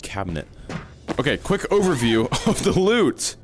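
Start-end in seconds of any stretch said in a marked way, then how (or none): 1.2-3.4 high, laughing through it. Real, not acted. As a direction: chuckle mid-sentence. Laughs restarting overview.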